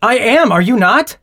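heated admission turned into a challenge; angry retort. Acted. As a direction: fire back, openly angry. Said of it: "behaving as if you're angry about this".

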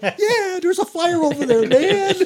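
very high, laughing sarcastic mock reply; sarcastic retort. Rookie answers the scared firefighter, very high and sarcastic. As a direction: throw it back high and mocking.

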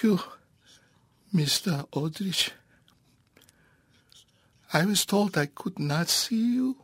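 put-on high, pleading old rabbi; a humble old rabbi begging to be heard; elderly rabbi voice. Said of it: "I'll do both voices of the rabbi".

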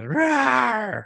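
high playful dinosaur roar; a single drawn-out rawr. Retold or imitated. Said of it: "you have Steven Spielberg's voice".

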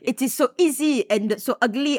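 imitated French accent. Friend quoting the Dauphin's line, imitated French accent. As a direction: quote him in a mock French accent. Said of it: "Doesn't he say".

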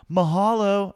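high, loud sing-song mahalo; mock mahalo. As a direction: chirp it like an eager tourist. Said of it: "right for me to be like".

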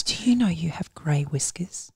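hushed unvoiced aside; whispering. Real, not acted. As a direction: whisper it secretly. Wife whispers during podcast.

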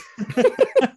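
a loud, high laugh; high burst of laughter. Real, not acted. Laughing at the boyo joke.

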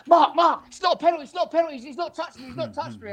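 high, frantic protest to the ref; a hammed-up player begging not to get the penalty. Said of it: "He's jumped up".

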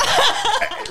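big burst of laughter; real laugh breaking out; loud laugh. Real, not acted. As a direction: laugh out loud at your own joke. Laughs at own joke.

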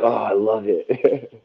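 slow, drawn-out approval; mildly drawn-out delight; retold friend's approval. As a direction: savour it like a friend loving the name. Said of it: "Miguel's like".

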